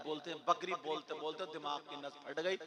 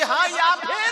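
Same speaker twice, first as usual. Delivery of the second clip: very loud, high comeback; challenging wife; 12 semitones higher, 17 dB louder, slower than usual. Acted. Wife daring her husband to finish, loud sharp challenge. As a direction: throw the threat straight back, shouted.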